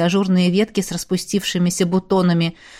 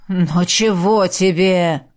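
slow drowsy mumble; just woken, groggy and slow; sleepy voice; 3 semitones lower, slower than usual. Acted. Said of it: "сонным голосом отозвался дух". (сонный, заспанный голос)

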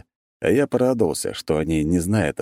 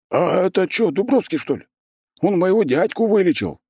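high, loud excited call; shout from crowd; 13 semitones higher, 3 dB louder than usual. A narrator's reading. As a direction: shout it out from the back. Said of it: "послышался крик из толпы".

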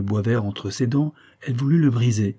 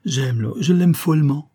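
put-on louder, higher girlish rapture; enthusiastic; 6 semitones higher, 5 dB louder than usual. A narrator's reading. Girl declaring her love of the moonlit pond, enthusiastic. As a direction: gush it, swelling with delight. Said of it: "dit-elle avec enthousiasme".

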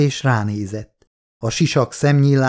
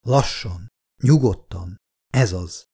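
soothing; 3 semitones lower than usual. Acted. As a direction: talk him down gently, breath by breath. Lex calms panicking Wieland.

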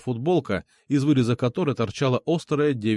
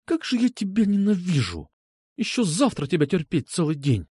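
understated seething, higher voice; hissed in anger; 5 semitones higher than usual. A narrator's reading. Girl who must endure him tomorrow, seething. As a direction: seethe with restrained loathing, starting high. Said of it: "а потом прошипела".

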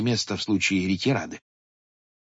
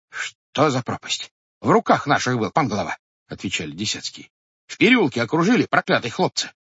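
high, loud flustered excuses; bewildered watchmen; 8 semitones higher, 4 dB louder than usual. A narrator's reading. Watchmen explaining to the head how they lost the captive. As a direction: pour out the story in a rush of dismay.

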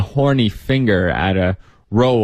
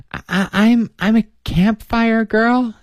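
put-on nervous little girl; 12 semitones higher than usual. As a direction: stumble over it like a shy child. Nervous girl stammering her answer.

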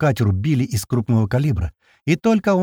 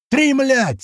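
very high strained count shout; high narrated holler; yelling; 14 semitones higher than usual. A narrator's reading. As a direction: yell the count as a curse. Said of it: "снова я ору".

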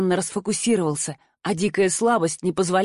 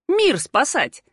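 bravado tone; 5 semitones higher, much slower than usual. A narrator's reading. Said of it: "добавила странным бравирующим тоном".

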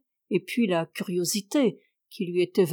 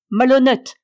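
loud, higher angry cry; shouted insult; 4 semitones higher, 8 dB louder than usual. A narrator's reading. Uncle shouting after the departing nephew, loud angry cry. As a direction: hurl the insult after him. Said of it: "me cria-t-il".

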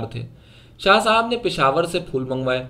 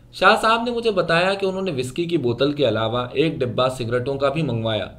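plain reading voice

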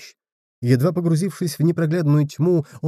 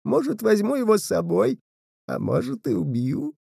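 put-on eerie spirit boy; 7 semitones higher than usual. A narrator's reading. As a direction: toy with him coldly. Spirit threatens the dog.

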